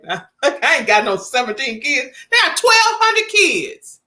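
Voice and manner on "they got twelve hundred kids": incredulous exclaim. Real, not acted. Exaggerating for comic effect.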